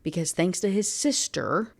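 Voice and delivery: usual speaking voice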